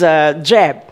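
ordinary talk

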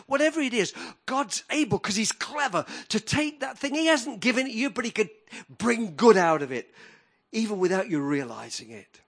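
high, loud building sermon cry; full-force preaching. Acted. Preacher rousing the congregation, loud and high. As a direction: preach it out to the hall.

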